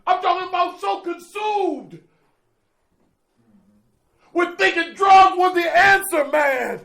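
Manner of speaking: high, loud preacher-style shouting; fired-up shout. Real, not acted. Speaker shouting to the meeting about his old addiction, fired up. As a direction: hammer it home to the room.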